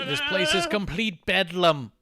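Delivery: high, slow, overwrought mock lament; mock Regal. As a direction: ham up a wailing old wrestler. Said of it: "He says, like".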